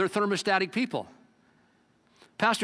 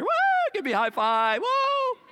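high, louder happy whoop; mock euphoric whoop; 3 dB louder than usual. Preacher acting out a happiness high, whooping loud and high. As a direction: whoop like you're on top of the world.

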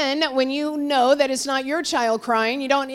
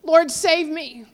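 higher-pitched, slow retold plea; moderately raised, acted cry for rescue; Peter crying out; 6 semitones higher, 4 dB louder, slower than usual. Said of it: "he cried out with just few words".

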